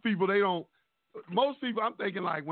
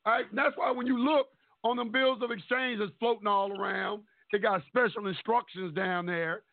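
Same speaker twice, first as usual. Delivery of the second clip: ordinary talk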